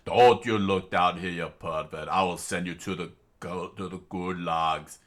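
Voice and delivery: put-on Russian accent, scolding a pervert. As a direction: threaten him in Russian accent. Tatiana scolding.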